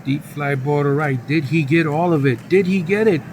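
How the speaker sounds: loud, high home run call; excited play-by-play. Pretend. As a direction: erupt into a grand slam call. Calls a grand slam live.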